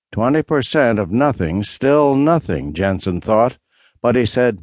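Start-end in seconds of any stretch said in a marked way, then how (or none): none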